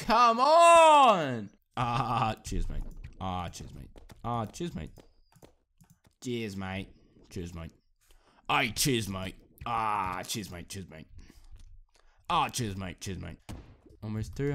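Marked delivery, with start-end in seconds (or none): 0.1-1.5 loud, high, drawn-out cry of exasperation; frustrated wail. Real, not acted. Frustrated after failing in game. 1.8-13.4 put-on Aussie accent, tossed off repeatedly; Australian accent. Shown as a demonstration. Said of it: "That's how you do an Australian accent".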